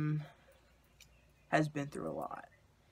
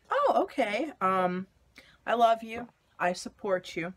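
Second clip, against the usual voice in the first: supportive mom; 6 semitones higher, 7 dB louder than usual. Retold or imitated. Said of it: "my mother was like".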